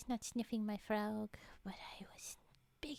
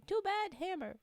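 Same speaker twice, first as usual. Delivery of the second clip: higher-pitched, punchy brush-off; mock defiant dismissal; 8 semitones higher, 4 dB louder than usual. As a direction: cut them off with playful defiance. Acting out rebuff to button-pushers.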